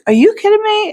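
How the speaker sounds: shot way up high in disbelief; high incredulous squeak. Retold or imitated. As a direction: squeal the question like you can't believe it. Incredulous reply to designer's claim.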